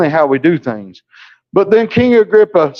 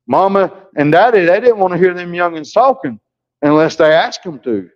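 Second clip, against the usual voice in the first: ordinary delivery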